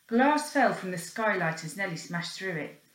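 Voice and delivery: plain reading voice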